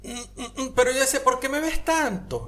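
high-pitched puzzled hum into a question; puzzled inner voice. Retold or imitated. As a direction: whine the question up high, bemused. Voicing his thoughts as she stares.

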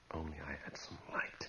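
a hushed whisper in the dark; whisper to himself. Acted. Man alone in a dark room, whispering. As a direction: breathe it out under the breath.

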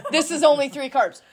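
high-pitched imitated protest; mock-indignant explanation, voice pushed up; indignant arguing. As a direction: argue it like an exasperated client. Client argues his case, retold.

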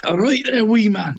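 high-pitched mock Scottish accent. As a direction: put on a Scottish accent, pitched right up and laughing at the end. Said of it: "say it in a Scottish accent".

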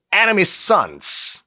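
deliberate Korean-accented English; homemade English pronunciation. Shown as a demonstration. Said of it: "제 발음이었습니다".

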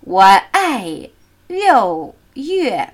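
slow, with "ai", "Liu" and "Yue" each falling; falling tones, drilled slowly. Shown as a demonstration. Teacher modelling "I love June", falling tones. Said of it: "Zwei fallende Töne".

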